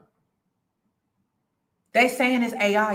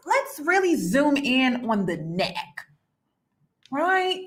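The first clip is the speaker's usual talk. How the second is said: usual speaking voice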